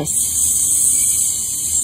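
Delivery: long, sustained sss; drawn-out snaky s; snake hiss. Shown as a demonstration.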